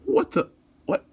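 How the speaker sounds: very high, loud startled blurt; acted shock. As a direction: blurt it out in high-pitched disbelief. Shock at seeing broken finger.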